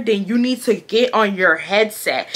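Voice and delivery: ordinary talk, nothing marked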